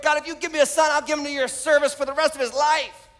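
retold prayer, pleading with God; desperate prayer. Barren woman begs God for a son, desperate prayer. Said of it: "she asked God desperately".

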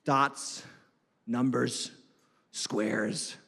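low, soft word-by-word muttering; clueless reader. Acted. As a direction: pretend to puzzle slowly over a page. Mimicking people puzzling over the books.

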